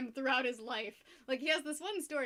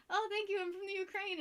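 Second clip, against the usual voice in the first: imitating a woman in a high voice; high-voiced quote of a thankful woman; woman's reply; 9 semitones higher than usual. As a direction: pitch it way up as her grateful reply.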